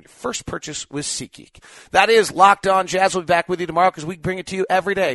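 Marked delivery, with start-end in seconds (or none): 1.9-5.2 announcer tagline. Acted. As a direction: announce the show name big. Show close tagline.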